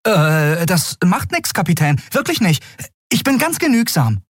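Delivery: high, eager reassurance; eager boy. Acted. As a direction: insist he needs nothing. Miko eager. (eifriger Junge, hoch)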